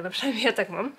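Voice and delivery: ordinary talk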